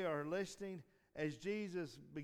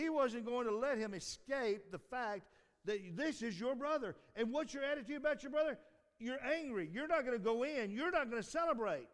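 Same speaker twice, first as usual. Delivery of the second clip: higher, louder preaching push; preaching hard; 10 semitones higher, 5 dB louder than usual. Acted. Preacher confronting the listeners with rising preaching. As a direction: press the point on the congregation. Preacher driving the point home.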